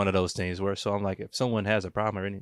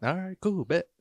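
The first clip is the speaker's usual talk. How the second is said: mock casual brush-off; 9 semitones higher than usual. Voicing himself leaving.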